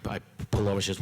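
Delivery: ordinary talk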